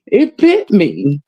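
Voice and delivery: mock excuse maker. Mimicking friend making excuses.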